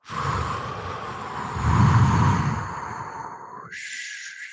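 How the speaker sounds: long, audible breathy sigh out; long exhale. Real, not acted. Guide settling the group into meditation, long exhaled sigh. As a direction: let out a slow, airy breath.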